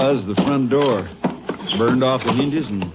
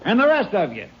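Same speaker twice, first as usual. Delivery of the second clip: raised, scolding voice at the crowd; 8 semitones higher than usual. Acted. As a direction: chase the gawkers back, sharply. Sheriff yelling at onlookers.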